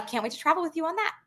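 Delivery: ordinary talk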